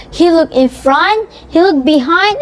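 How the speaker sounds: usual speaking voice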